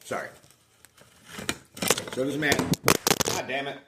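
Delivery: talking through a mouthful; words around a bite of food; chewing while talking. Real, not acted. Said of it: "I'm trying to eat".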